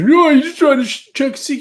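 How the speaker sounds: mock the scolder in a shrill squeal; mocking whiny complainer. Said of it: "People say".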